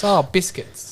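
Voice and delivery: mock-annoyed kid's mild curse; dad imitating his daughter's pet curse; little daughter. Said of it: "she goes".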